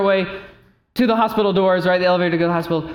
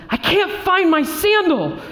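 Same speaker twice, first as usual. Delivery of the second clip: mock frantic wife, pitched high; high, drawn-out mock wail; distressed wail; 11 semitones higher, slower than usual. Wife upset about lost sandal.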